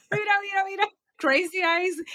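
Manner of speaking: agudo y fuerte, medio gritando de entusiasmo; llamada entusiasta. Real, not acted. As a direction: pide atención con un grito alegre. Ella llama la atención entusiasmada. (la actriz, entusiasmada, reclama la atención del entrevistador medio gritando)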